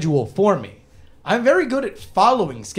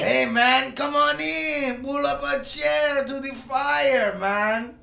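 slow, high, laid-back tavern keeper; Hearthstone bartender voice; 9 semitones higher, much slower than usual. Pretend. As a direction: welcome them in like a stoner innkeeper. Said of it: "bartender voice and Cheech Marin".

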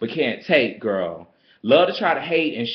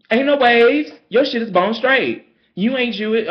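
much higher and louder sassy read; heated read; 9 semitones higher, 5 dB louder than usual. Retold or imitated. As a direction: hit her with it, loud and high.

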